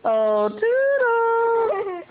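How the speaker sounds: very high, very slow squeaky mouse voice; Mickey Mouse. Retold or imitated. Mother showing the baby Mickey's greeting, high and drawn out. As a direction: stretch it high like the cartoon mouse. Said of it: "What does a Mickey Mouse say?".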